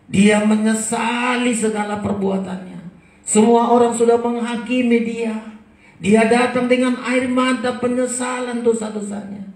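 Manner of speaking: high, loud impassioned preaching; fiery preaching. Acted. Preacher to his congregation about repentant tears, fiery preaching. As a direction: proclaim it to the back row.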